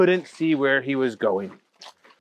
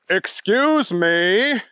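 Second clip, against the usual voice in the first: a little higher, slow polite voice; polite bunny; 5 semitones higher, much slower than usual. Acted. Easter Bunny politely interrupts Booger, a little higher and slow. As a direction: politely ask for attention.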